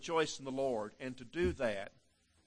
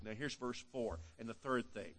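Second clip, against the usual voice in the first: ordinary talk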